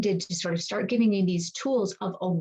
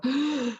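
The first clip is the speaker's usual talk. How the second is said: sharp high intake of breath; startled gasp; 6 semitones higher than usual. Shown as a demonstration. Coach showing the nervous system's alarm, a sharp startled gasp. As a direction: gasp as if something's wrong. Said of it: "you can hear your nervous system go".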